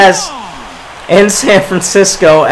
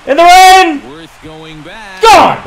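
bigger excited shout; 10 semitones higher than usual. Real, not acted. Gamer topping his own call in the rain. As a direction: push it further with joy. Repeats, even higher.

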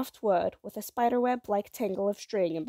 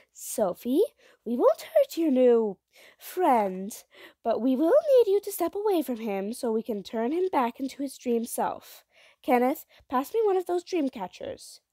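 firm mother; 5 semitones higher, 4 dB louder than usual. A narrator's reading. Mother firmly coaxes her child.

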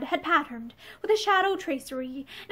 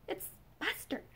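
very soft, higher, fading whisper; pitiful; 4 semitones higher, 16 dB quieter than usual. A narrator's reading. Woman reminds her lover of Buster, pitiful and hushed. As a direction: barely get the name out, helplessly. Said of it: "she said again pitifully".

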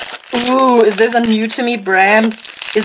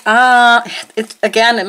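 ordinary talk, nothing marked

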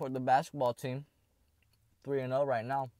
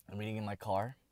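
ordinary delivery

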